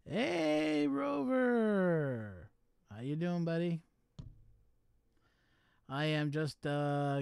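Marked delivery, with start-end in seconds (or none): 0.1-2.5 high, loud, long-held hail; drawn-out greeting call. Real, not acted. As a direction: call out to a buddy, stretching the name. Calling a greeting to Rover.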